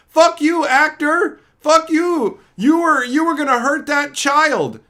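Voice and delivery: high, loud screaming fan; mock outraged fan. Fan yelling at the Furiosa actor, loud mock rage.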